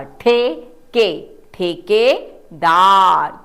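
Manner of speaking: ठेके then ठेकेदार built up very slowly; slow sounding out. Shown as a demonstration. Teacher to class, slowly building the word. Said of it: "ठ को एक ही मात्रा".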